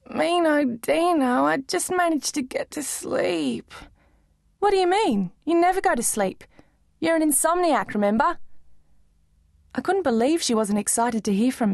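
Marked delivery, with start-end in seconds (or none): 0.1-3.7 slow, groggy half-awake voice; just woken, words dragging; sleepy drawl. A narrator's reading. Woken friend on phone. 4.6-8.4 put-on higher, bright teen girl; girlish Dino voice. A narrator's reading.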